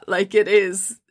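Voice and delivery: ordinary talk, nothing marked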